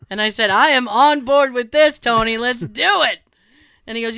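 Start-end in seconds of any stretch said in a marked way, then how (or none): 0.5-3.2 loud, emphatic enthusiasm; mock eager declaration. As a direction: declare it with gusto, higher and louder. Retells eagerly agreeing to brandy.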